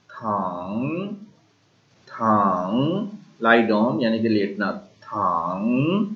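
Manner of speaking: थांग very slow, low, dipping then rising; third tone thang. Shown as a demonstration. Teacher stretching the third tone on thang. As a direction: draw थांग out slowly, down and up. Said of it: "third जो है tone".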